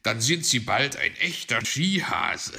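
amused line capped with a chuckle; laughing fox. A narrator's reading. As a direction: tease loudly, then laugh. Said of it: "lachte Herr Fuchs".

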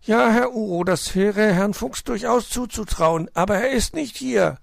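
high, snuffling hedgehog lady. Acted. Frau Igel meldet sich am Telefon, hohe Igelstimme. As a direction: answer the phone with a snuffle.

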